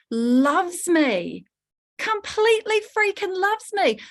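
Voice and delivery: soaring high on completely freaking loves me; gushing emphasis. Acted. Coach telling the client her ex's soul loves her, gushing and high. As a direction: lift it up with delight.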